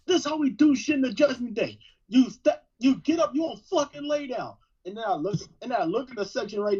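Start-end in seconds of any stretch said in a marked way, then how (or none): 0.1-4.5 raised-pitch, mock ringside taunting; trash talk. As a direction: act out cocky wrestler trash talk. Acting out own ringside trash talk.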